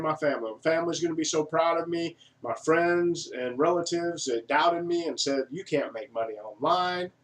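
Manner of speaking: high, loud mock Southern accent as an eager buyer; hammed-up Southern accent, dreaming out loud. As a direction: imitate a naive Southern buyer full of big plans. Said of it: "I don't know why I went Southern there".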